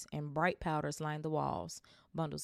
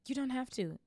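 higher, a shy little protest; shy Harry; 8 semitones higher than usual. Acted. Harry protesting Hagrid's birthday present, shy and higher. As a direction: brush off the kindness, embarrassed.

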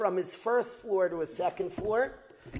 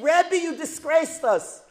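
hurt reproach; 5 semitones higher, 7 dB louder than usual. Retold or imitated. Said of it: "So what are they saying?".

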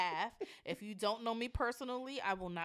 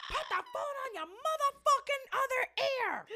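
very high, loud exasperated yell; high-pitched shouted demand, acted out; retold yelling; 5 dB louder than usual. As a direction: yell it at someone hard of hearing. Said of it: "And I be yelling at him".

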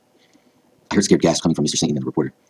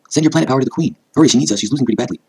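high, raised urging; urgent command; 10 semitones higher, 5 dB louder than usual. A narrator's reading. Sailor Pluto ordering scouts to power the queen, high urgent command. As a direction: urge the team to hurry.

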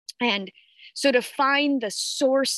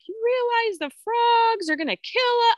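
much higher, panicked mock shriek; retold freak-out. Mom reenacting freaking out at her kids, high panicked squeal. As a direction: replay your panic at the frogs, pitched way up. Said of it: "I flipped the fuck out today".

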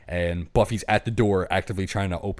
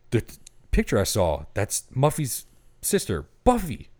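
rising, louder dawning realization; realizing; 6 semitones higher, 3 dB louder than usual. Retold or imitated. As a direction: let it climb as the truth hits her. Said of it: "Kit puts it together in her head".

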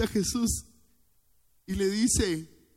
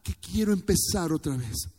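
louder, much higher fervent preaching; preaching at full force; 9 semitones higher, 7 dB louder than usual. Real, not acted. Pastor preaching fervently to his church, louder and higher. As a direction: preach it out with fire.

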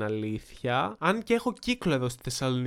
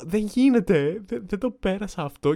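high, raised-voice disbelief; upset disbelief; 6 semitones higher, 7 dB louder than usual. Retold or imitated. Waiter to himself after his first telling-off, upset disbelief. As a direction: protest it loudly, as if shaken. Retelling his shock, near tears.

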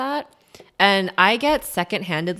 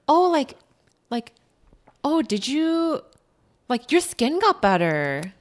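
a lightly higher prying mock voice; mocking acquaintance; 6 semitones higher than usual. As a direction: poke fun at a nosy comment. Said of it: "people who are like".

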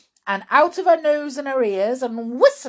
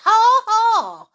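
very high, loud spoken "ha ha"; triumphant mock laugh; crowing cry; 13 semitones higher, 11 dB louder than usual. Grandma catches George out, triumphant high cry. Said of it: "she cried".

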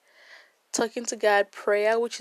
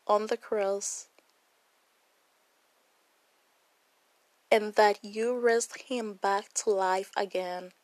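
salvation prayer. Acted. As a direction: lead them word by word in prayer.

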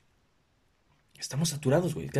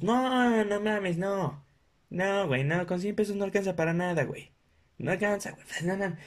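higher protesting coworkers; mock protest; 7 semitones higher than usual. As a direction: protest the cheap budget. Coworkers rejecting cheap gift. (compañeros protestando, tono agudo)

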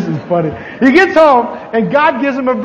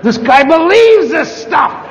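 exasperated outburst; 6 semitones higher, 3 dB louder than usual. Real, not acted.

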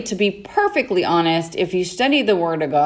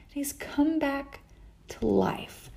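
hushed awe; 5 semitones higher, 12 dB quieter, slower than usual. Acted. Reading father's joyful line, hushed.

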